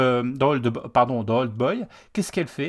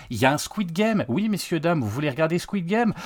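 ordinary delivery

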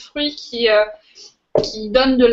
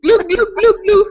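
glouglou imité; 7 semitones higher, 6 dB louder than usual. Pretend. Drinking toast, gulping noise.

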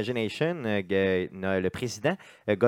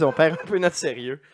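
usual speaking voice

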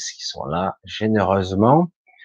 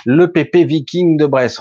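amused exclamation; 7 semitones higher, 8 dB louder than usual. Real, not acted. Host reading a viewer's nickname, amused and loud. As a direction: read the nickname out high and loud with delight.

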